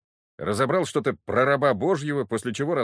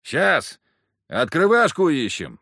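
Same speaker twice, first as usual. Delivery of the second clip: loud retort; 5 semitones higher, 6 dB louder, slower than usual. A narrator's reading. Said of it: "бросил я".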